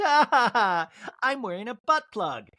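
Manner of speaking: high, slow, laughing mock confession; high, slow giggling mock Chris; giggly confession. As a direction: giggle it out like a naughty secret. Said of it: "Chris tells us".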